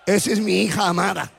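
low, measured voice from heaven; voz de Dios. Acted. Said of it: "desde el cielo Dios decía". (voz de Dios, grave y pausada)

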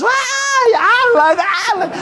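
high-pitched mock squeal of adoring fans; screaming fangirls. Pretty girls in hell spot Alan, mock fan squeal. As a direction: squeal his name like a starstruck crowd.

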